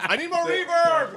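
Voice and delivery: mock announcer. Mocking announcer voice.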